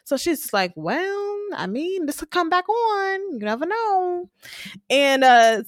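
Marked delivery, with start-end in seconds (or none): 0.8-4.3 high nasal Blanca voice, soft and teasing; Blanca impression. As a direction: pitch it up and nasal like Blanca hinting. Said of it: "It's just that nasal".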